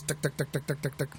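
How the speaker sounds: soft, even machine-gun ticking; imitated gun rattle. Shown as a demonstration. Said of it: "sounds very much like a gun".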